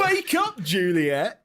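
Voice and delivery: very high, loud theatrical shout; hammed-up cry. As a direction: bellow it like a ham actor over a body. Actor wailing over Juliet.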